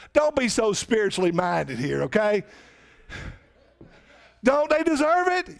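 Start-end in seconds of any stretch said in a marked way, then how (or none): 4.4-5.6 much higher and louder, demanding; preacher demanding an answer from the church; insistent question. Real, not acted. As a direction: hammer the question home. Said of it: "hey, don't they deserve it?".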